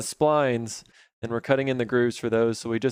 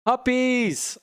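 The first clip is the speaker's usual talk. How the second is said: high-pitched call to the dogs; 12 semitones higher than usual. Real, not acted. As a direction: sing out to the puppies. Calling the puppies inside.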